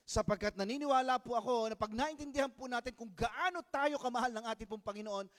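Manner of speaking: malakas na pangangaral, puno ng damdamin; loud preaching. Acted. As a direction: ipangaral nang buong lakas at sigla. (malakas at masidhing pangangaral)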